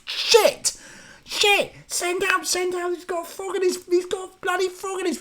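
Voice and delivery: very high, frantic mock panic; hammed-up terror at the frog; panicked yell. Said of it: "the other guy's like".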